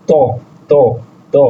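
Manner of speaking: ত said as a separate syllable, repeated with pauses; letter pronunciation demo. Shown as a demonstration. Teacher showing learners the Russian T sound, demonstrating ত. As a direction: sound out ত on its own, three times. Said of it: "টি এর উচ্চারণ হবে".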